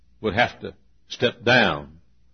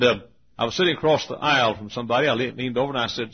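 ordinary talk, nothing marked

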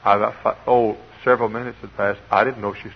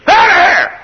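high, loud mock yell; shouted order; 12 dB louder than usual. Old lady stops boys, retold.

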